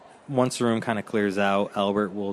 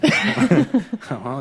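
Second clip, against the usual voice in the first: laughing; 12 semitones higher, 6 dB louder than usual. Acted. Innkeeper laughs while talking.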